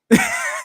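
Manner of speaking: short high squeal of a laugh; a yelp of a laugh in disbelief; squeaky laugh. Real, not acted. Streamer reacting to the chat, squeaky laugh.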